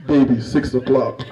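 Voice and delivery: low, menacing monster voice; Venom. Acted. Womb alerts its owner to a baby, deep Venom voice. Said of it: "a woman's womb sounds like Venom".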